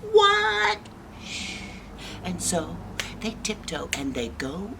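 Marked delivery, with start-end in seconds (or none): 0.1-0.8 high, startled exclamation; shocked bears. Acted. All the bears hear of the intruder, shocked. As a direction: blurt it out in shock, pitched way up. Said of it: "And they're all like".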